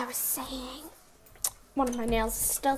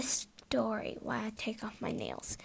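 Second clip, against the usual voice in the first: whispering; 3 semitones lower, 8 dB quieter than usual. Real, not acted. Whispered aside while removing nails.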